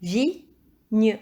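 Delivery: Vigne. as two separated syllables. Shown as a demonstration. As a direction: repeat "Vigne." as vi, pause, gne. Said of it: "à la première ou à la deuxième syllabe".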